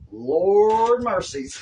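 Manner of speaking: high, loud, drawn-out cry of dismay; long, rising Southern-style lament; exasperated exclamation. Real, not acted. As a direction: stretch it out in mock horror. Exclaims at own messy hair.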